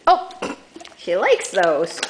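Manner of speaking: normal talking voice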